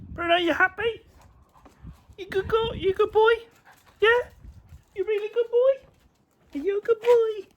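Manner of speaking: very high cooing dog voice; talking to a dog. Real, not acted. Talking to pets.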